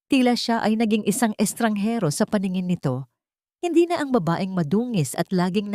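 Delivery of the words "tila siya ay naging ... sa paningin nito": plain reading voice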